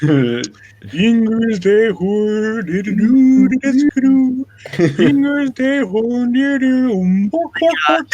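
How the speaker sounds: slow mock Swedish Chef gibberish; singsong Swedish Chef babble. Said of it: "the Swedish Chef".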